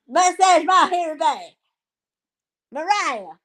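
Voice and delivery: high-pitched, hammed-up character voice; mock scolding character. Streamer acting out a threat to Dalton, high mock scolding. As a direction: play a worked-up figure threatening in a high voice.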